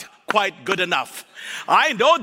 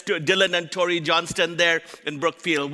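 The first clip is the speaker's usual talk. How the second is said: usual speaking voice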